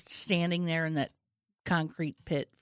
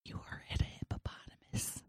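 very soft whisper; 12 dB quieter than usual. Acted. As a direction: whisper back what you misheard. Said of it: "We are all whispering".

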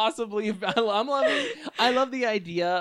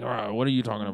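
low, soft grumbling mutter; demonstrated mutter; 8 semitones lower, 5 dB quieter, much faster than usual. Shown as a demonstration. Answering how do you mutter, a low muttered demo. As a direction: grumble it under your breath. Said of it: "a mutter. Like".

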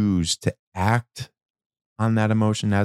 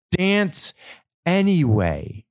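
excited burst; 10 semitones higher, slower than usual. Real, not acted. Urging listener to dance anyway.

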